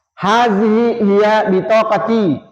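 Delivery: slow, even statement; answer intonation. Shown as a demonstration. Teacher voicing the student's answer for the class, slow and even. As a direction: hand it over with a slow answering tune. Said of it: "Jadi intonasinya seperti itu".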